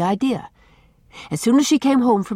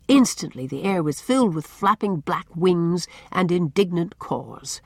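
ordinary delivery, nothing marked

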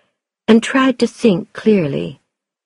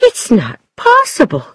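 higher, louder protest; crying out in disbelief; 4 semitones higher, 4 dB louder than usual. A narrator's reading. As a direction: exclaim it as an objection, pitched up. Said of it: "Then she cried out".

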